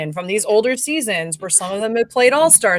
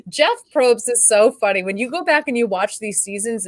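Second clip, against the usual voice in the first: ordinary delivery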